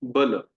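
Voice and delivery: बल said short, both vowels brief; quick clipped बल, short a sounds. Shown as a demonstration. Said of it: "आप सब उच्चारण कीजिए".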